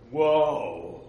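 drawn-out amazed gasp of wonder; amazed whoa. Retold or imitated. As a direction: let the wonder out in one long breath. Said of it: "And I go".

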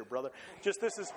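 ordinary delivery

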